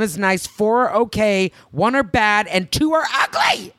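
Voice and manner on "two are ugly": higher, punchy comic outburst; mock outrage. Real, not acted. Telling her co-host her foot scores, mock-outraged. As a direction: land the punchline up high.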